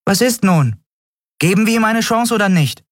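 high-pitched, impatient character voice; high, insistent demand to decide; Nelen, impatient. Acted. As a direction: push for an answer, pitched high.

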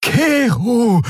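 high, drawn-out call of the name; alarmed cry. A narrator's reading. Xea tries to hold Keru back, alarmed cry. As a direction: call out urgently to stop him.

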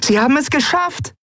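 very high-pitched cry of joy; jubilant cheer. A narrator's reading. Nelen cheers as the crew has made it, jubilant. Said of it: "hörte Miko Nelen jubeln".

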